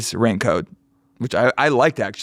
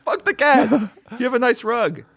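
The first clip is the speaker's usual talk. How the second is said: mock outrage; 13 semitones higher than usual.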